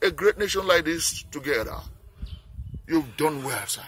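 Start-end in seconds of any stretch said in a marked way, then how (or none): none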